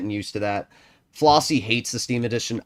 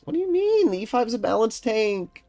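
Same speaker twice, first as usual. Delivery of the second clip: mocking chatter voice; 13 semitones higher than usual.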